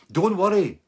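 high, loud don't worry; loud reassurance. Retold or imitated. Big guy calming a nervous stranger, high and loud. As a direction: reassure too eagerly and too loud.

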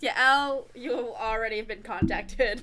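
loud, slow announcer voice; announcer reveal. Acted. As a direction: proclaim the winner slowly. Announcing the next guest.

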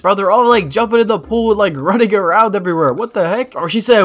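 ordinary delivery

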